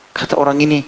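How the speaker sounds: normal talking voice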